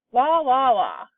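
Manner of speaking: put-on loud baby cries falling away at the end; baby wailing again, then fading off; baby bawling. A narrator's reading. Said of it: "she said".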